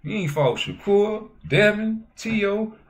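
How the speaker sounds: heated rant. Real, not acted. Ranting at Tank over his fights.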